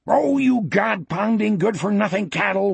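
high-pitched exasperated bellow; angry bellow. A narrator's reading. Wolf cursing his cattle as he charges downhill, angry bellow. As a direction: bellow a curse at the herd. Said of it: "Wolf bellowed".

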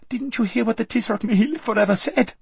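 put-on very high squeaky girl; a spoiled girl squeaking in protest; squeaked. A narrator's reading. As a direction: squeak it out in dismay. Lazareline frightened.

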